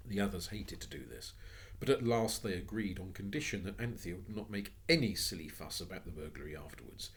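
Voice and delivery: ordinary delivery, nothing marked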